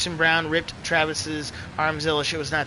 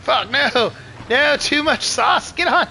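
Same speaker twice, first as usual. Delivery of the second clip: high, loud real outburst; frustrated yell; 8 semitones higher, 5 dB louder, slower than usual. Real, not acted. Gamer yelling as he overdoes the throttle, frustrated yell. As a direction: yell in panic at your own mistake. Crying out during a bad moment riding.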